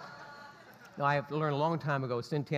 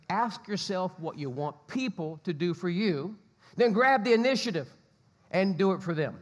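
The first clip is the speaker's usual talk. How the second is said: emphatic scripture reading; 3 semitones higher, 4 dB louder than usual. Acted. Pastor reading scripture aloud to the congregation, emphatic. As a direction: proclaim the verse to the congregation.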